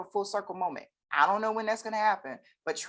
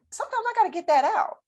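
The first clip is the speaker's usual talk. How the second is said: excited; 14 semitones higher, 7 dB louder, faster than usual. Real, not acted. Emphatic confession.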